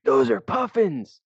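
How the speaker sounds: high, slow thrilled cry of discovery; excited shout. Retold or imitated. On a whale-watching boat, spotting puffins, a slow thrilled high shout.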